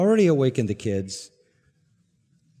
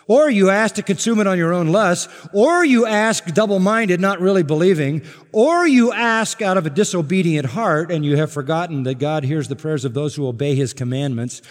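loud, higher, driving list of warnings; full-force preaching; 5 semitones higher, 6 dB louder than usual. Acted. Preacher listing wrong ways to ask, loud and driving. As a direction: hammer each "or you ask".

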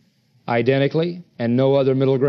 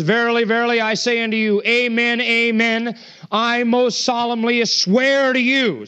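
forceful; 5 semitones higher than usual. Retold or imitated. Preacher voicing Christ's solemn oath to his accusers, forceful. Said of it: "he gets more forceful and says".

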